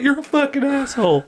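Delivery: high-pitched mock child's insult; upset little kid. Little boy cursing the deer hunter, mock upset. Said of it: "This little kid's like".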